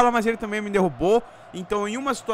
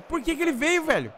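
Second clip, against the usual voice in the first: high, exasperated question; indignant; 9 semitones higher, 4 dB quieter than usual. Real, not acted. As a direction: complain at the game. Complaining about AI teammate.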